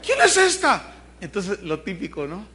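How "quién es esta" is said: mock shocked wife. Wife catches husband with lover, hammed-up outrage. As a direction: shriek it like a telenovela wife.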